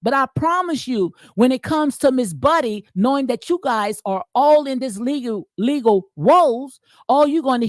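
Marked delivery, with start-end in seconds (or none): none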